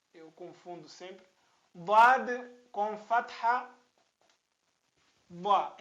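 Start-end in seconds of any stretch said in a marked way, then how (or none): 1.7-5.8 Dha said loud, tongue to the upper teeth; Arabic Dad with fatha. Shown as a demonstration. Teacher modelling Dha loudly for students. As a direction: hit Dha with a firm Arabic D. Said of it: "representar o som dessa".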